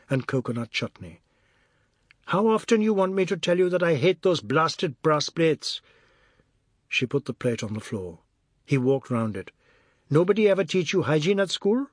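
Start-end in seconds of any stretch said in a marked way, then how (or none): 2.3-5.8 husband's exasperated, higher-pitched outburst; angry complaint. A narrator's reading. Husband to wife about the brass plates, angry complaint. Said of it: "depression had turned to anger". 10.1-11.9 higher-pitched, mocking question; jocular anger. A narrator's reading. Husband needling wife about the food, jocular anger. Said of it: "but he spoke jocularly".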